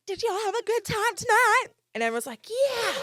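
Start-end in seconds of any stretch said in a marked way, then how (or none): 0.1-1.7 high, loud hype-up shout; drunken crowd call. Retold or imitated. Drunk woman addressing everyone in the elevator, high hype shout. 2.5-2.9 high, louder "yeah"; imitated crowd cheer. Elevator riders answering a drunk woman, high imitated cheer.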